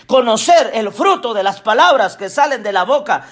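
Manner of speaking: ordinary talk, nothing marked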